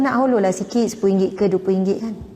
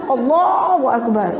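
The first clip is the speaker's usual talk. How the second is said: slow and pressed, a mock angry mother; mock angry parent; 2 semitones higher, slower than usual. Shown as a demonstration. Mother pinching her child while praising God, slow mock anger. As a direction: grind it out slowly while pinching. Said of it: "Sambil tangan cubit".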